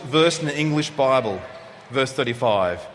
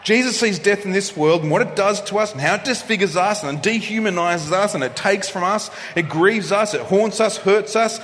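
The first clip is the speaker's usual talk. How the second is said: rising indignation; 5 semitones higher than usual. Acted. Preacher telling the congregation of Jesus' anger at death, mounting indignation. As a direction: climb steadily until the anger at death breaks out.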